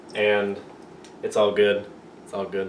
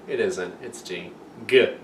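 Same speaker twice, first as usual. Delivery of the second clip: normal talking voice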